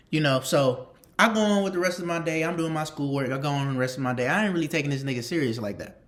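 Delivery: ordinary talk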